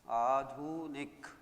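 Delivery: आधुनिक said very slowly with long first aa; slow careful word. Shown as a demonstration. Teacher to pupils, slow careful आधुनिक. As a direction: draw out आधुनिक syllable by syllable. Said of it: "आधुनिक शब्द होता है".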